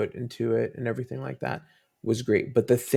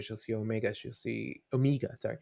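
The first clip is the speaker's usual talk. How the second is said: ordinary talk, nothing marked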